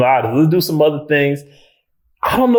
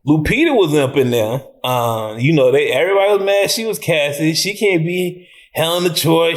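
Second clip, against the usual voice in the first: ordinary delivery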